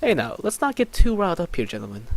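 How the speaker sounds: cool the gentlemen down in character; peacemaker voice. Acted. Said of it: "And I'm like".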